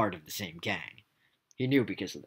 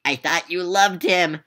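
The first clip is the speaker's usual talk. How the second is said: Piz's voice; 9 semitones higher, 10 dB louder, slower than usual. Acted. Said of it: "said Piz".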